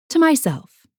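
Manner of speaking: a cheery solo toast; toast out loud. Acted. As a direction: lift the glass and toast yourself aloud. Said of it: "I say out loud".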